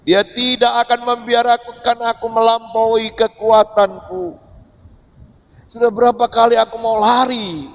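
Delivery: high, loud pulpit preaching; fervent preaching. Acted. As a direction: preach it with full conviction to the congregation. Preaching with rising force. (khotbah lantang dan berapi-api)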